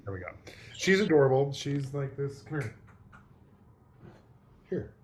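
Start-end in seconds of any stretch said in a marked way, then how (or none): none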